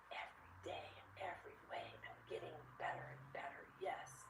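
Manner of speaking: soft whisper, said together; whispered mantra. Retold or imitated. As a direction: hush it to encourage a tired child. Soft chant to tired child.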